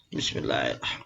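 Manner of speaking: unvoiced mumble past a cigarette; whispered with a cigarette in the lips; cigarette in mouth. Real, not acted. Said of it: "sambil ngerokok saya ya".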